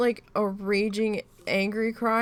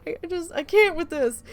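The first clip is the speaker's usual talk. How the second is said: higher, louder mock despair; retold overwhelm; 9 semitones higher, 5 dB louder than usual. Wife overwhelmed at night, mock despair. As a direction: throw up your hands in mock defeat. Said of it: "and I'm just like".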